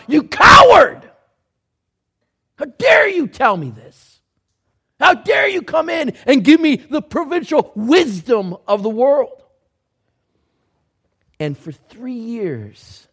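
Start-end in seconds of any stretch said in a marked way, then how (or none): 0.1-9.4 furious shout, much higher and louder; raging shout. Retold or imitated. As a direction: explode with indignant rage. Recalled rage inside.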